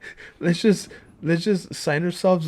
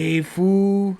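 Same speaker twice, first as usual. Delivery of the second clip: mock East Coast. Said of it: "The East Coast version?".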